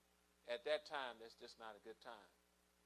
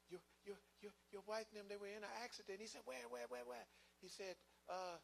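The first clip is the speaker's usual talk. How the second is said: stammering messenger; 9 semitones higher than usual. Retold or imitated. Messenger breaks news of crash.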